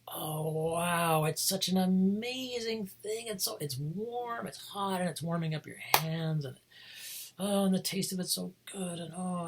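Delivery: high, slow, gushing rapture over tea; high, drawn-out sighs of tea bliss; blissful tea delight. Retold or imitated. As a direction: swoon over the first sip. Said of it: "it's like".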